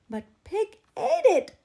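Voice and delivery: put-on high, loud childish whine; whining. A narrator's reading. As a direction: whine like a sulky kid. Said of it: "whined Turtle".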